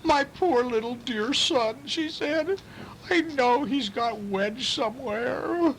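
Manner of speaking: high, slow, wailing mother; mock crying. A narrator's reading. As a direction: sob the words like a heartbroken mother, hammed up. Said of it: "Missus Little began to cry".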